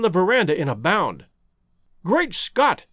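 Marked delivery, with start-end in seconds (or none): 2.0-2.9 high-pitched exclamation of alarm; startled cry. A narrator's reading. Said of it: "he cried".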